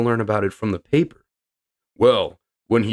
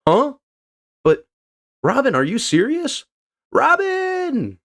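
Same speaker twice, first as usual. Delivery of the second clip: high startled cry of a name; high shocked shout; 14 semitones higher than usual. Acted. Voicing shocked crewmate.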